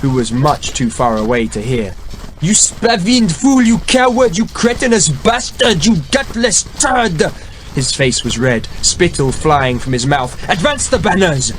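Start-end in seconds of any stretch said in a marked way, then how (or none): 2.4-7.3 furious tirade. A narrator's reading. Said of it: "the king screamed at his brother". 10.5-11.5 higher, ringing order to advance; king shouting an order; shouted command. A narrator's reading. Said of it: "the king shouted".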